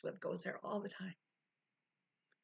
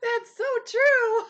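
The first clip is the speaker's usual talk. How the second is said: high, loud, slow through laughter; laughing hard; 14 semitones higher, 11 dB louder, slower than usual. Real, not acted. Streamer cracking up at a chat comment. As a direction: squeal it while cracking up.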